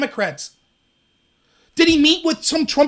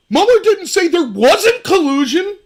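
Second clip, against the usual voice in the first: loud, slow sarcastic recital; mock talking point; 6 semitones higher, 7 dB louder, slower than usual. Host parroting critics, loud slow sarcasm. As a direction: sneer it like a parrot.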